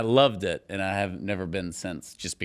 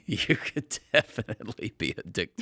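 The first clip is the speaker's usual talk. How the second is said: laughing through a teasing jab; giggling while teasing; 7 semitones higher than usual. Real, not acted. Laughing tease.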